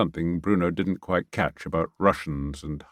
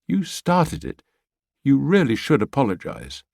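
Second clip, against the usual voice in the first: higher woman's voice, mild reproach; Pia, gentle; 5 semitones higher than usual. Acted. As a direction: coax him to apologise. Pia scolds Bertie gently.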